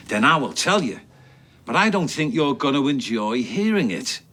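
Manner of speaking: escalating rant. Acted. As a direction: spit it out, losing your temper. Phelan explodes at Faye.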